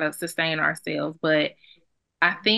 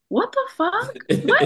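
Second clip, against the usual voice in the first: high, squeaky what the fuck; incredulous outburst at shallow dating questions; shocked disbelief. Real, not acted. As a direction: react as if it's absurd.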